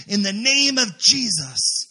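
loud, slow and emphatic command; firm spiritual rebuke. Retold or imitated. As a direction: declare it with force, stretching the words. Acted rebuke of a witch.

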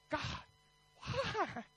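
small, high, hushed mock whine; pleading. Preacher acts himself answering God's rebuke, soft high mock plea.

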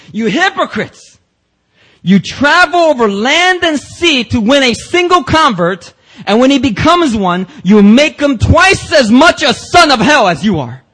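high, loud, slow condemning cry; harsh rebuke. Acted. Jesus rebuking the Pharisees, high, loud and harsh. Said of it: "I just don't think Jesus gets any harsher than this in his tone".

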